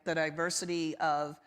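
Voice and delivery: usual speaking voice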